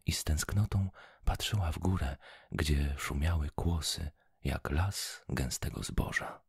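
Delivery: plain reading voice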